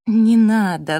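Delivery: urgent, imploring appeal; pleading. A narrator's reading. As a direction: beg them to stop. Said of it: "взмолился полушёпотом Джастин".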